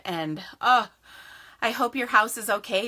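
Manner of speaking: ordinary delivery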